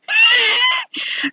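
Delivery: very high-pitched squeal of laughter. Real, not acted. Storyteller amused by own chicken punchline, high squealing laugh.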